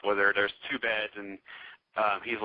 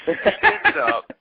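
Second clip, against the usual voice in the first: higher, louder, slow through laughter; laughing; 8 dB louder, slower than usual. Real, not acted. The teller cracking up retelling Fred's exit, laughing high and loud. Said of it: "laughing while telling".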